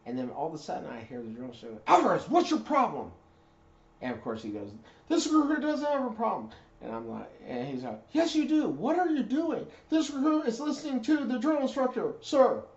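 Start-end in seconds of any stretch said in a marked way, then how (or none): 1.9-3.1 barked shout, way up high and loud; high, loud drill instructor shout; drill instructor yell. Retold or imitated. Drill instructor yelling at recruit. 5.1-6.5 high, loud recruit reply; recruit shouting back. Retold or imitated. Recruit answering drill instructor. 8.1-12.6 high, loud back-and-forth yelling; boot camp shouting. Retold or imitated. Drill instructor and recruit shouting.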